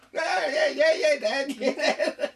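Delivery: high, loud, laughing mock reply; cheeky son answering his yelling father with a laugh; boy answering dad. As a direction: chirp it back up high, half laughing.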